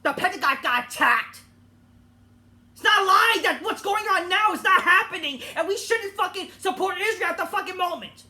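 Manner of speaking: loud, heated, jabbing each word; angry outburst. Real, not acted. Ranting to viewers about supporting Israel, loud and angry. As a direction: vent the outrage at full volume. Heated political rant opening.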